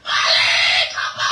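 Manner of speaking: loud breathy shout; screaming mother. Acted. The robbed mother screams at the thief, loud. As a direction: yell it at the thief.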